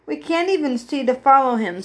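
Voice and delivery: usual speaking voice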